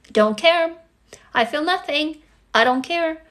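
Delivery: stubborn, climbing refusal; defiant indifference. Retold or imitated. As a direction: insist you feel nothing to whoever hurts you. Defiant child facing a beating.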